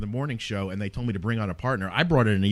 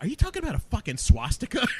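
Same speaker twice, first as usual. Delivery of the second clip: high-pitched mock disbelief, edged with laughter; disbelieving squeak on the verge of laughing; retold incredulous question; 11 semitones higher than usual. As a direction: ask it high, amazed and amused. Retold disbelief at caller's revelation.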